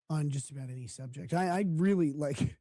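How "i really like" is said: ordinary talk, nothing marked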